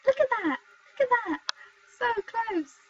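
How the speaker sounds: high-pitched groaning at the near miss; exasperated exclaiming. Real, not acted. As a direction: squeal at the near miss, real frustration. Lockpick nearly works, exasperated.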